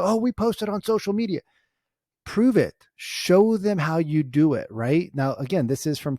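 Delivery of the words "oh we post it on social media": mocking agents. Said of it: "A lot of agents talk about".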